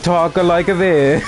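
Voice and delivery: exaggerated sing-song Italian accent. Shown as a demonstration. Fan showing how the dub's Italians talk, cartoon Italian accent. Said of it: "but in accents".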